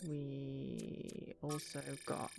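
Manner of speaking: whispering. Real, not acted. Quiet aside while playing.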